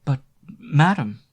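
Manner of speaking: slow, loud frightened plea; stammering. A narrator's reading. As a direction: stumble over it in alarm. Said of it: "stammered Annette".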